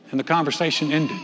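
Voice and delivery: usual speaking voice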